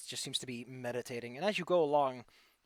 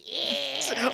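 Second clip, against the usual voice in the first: high witch squeak; hag squeak; 10 semitones higher, 5 dB louder than usual. Acted.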